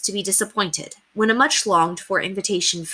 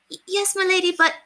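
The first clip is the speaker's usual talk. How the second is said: high, stammering maid's voice; a nervous servant stumbling over her words; timid maid; 12 semitones higher than usual. A narrator's reading. Maid answering her mistress at the door, high timid stammer.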